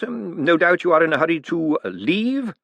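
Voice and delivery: a pointed little lift on leave; hint on leave. Acted. Bent hinting Moist should go, stress on leave. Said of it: "a slight inflection on leave".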